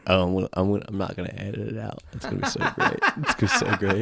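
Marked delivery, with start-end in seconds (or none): 2.1-4.0 laughing through the words. Real, not acted. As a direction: say it while cracking up. Laughing about keeping the blooper.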